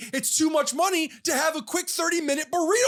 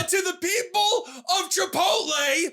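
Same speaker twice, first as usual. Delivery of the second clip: ranting; 7 semitones higher, slower than usual. Acted. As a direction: rant at the burrito makers.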